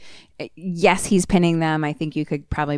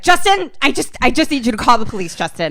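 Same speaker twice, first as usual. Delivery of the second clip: urgent pleading; 6 semitones higher, 4 dB louder, faster than usual. Retold or imitated. Desperate victim pleading with operator.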